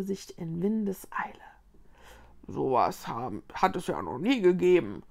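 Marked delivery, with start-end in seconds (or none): 2.5-5.0 put-on low, disgruntled old rowan; grumbling old tree. A narrator's reading. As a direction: grumble it down in the chest. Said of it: "brummte eine alte Eberesche". (alt und tief brummend)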